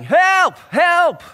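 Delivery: high, loud cry of Help; shouting for help. Retold or imitated. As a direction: yell it like a drowning man. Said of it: "just started shouting".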